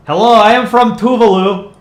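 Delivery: put-on high, loud, made-up Tuvaluan accent. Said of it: "Do a Tuvalan accent?".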